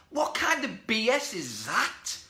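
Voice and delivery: indignant disbelief. Real, not acted. Actor rejecting an excuse he just voiced, indignant disbelief. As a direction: call out nonsense, slowly and high.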